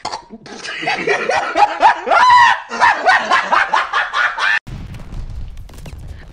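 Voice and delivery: long, high cackling fit; shrieking real laughter; hysterical laughter. Real, not acted. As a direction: burst out laughing uncontrollably. Bursts out laughing.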